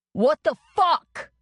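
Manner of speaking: loud, slow, outraged yell. A narrator's reading. Bloodied man yelling at his attacker, outraged yell. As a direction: bellow it in disbelief. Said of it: "Dylan yelled through a split lip".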